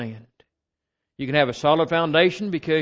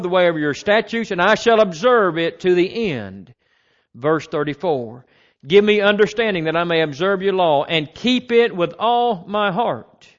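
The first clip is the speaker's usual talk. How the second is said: loud, measured psalm reading; scripture reading; 4 semitones higher, 7 dB louder than usual. Acted. Preacher reading Psalm 119 to the congregation, loud and measured. Reads psalm verses aloud.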